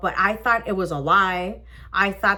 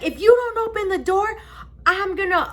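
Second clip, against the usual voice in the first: high, insistent warning; raised-pitch cop ultimatum; imitated officer's threat; 9 semitones higher than usual. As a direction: threaten through a closed door.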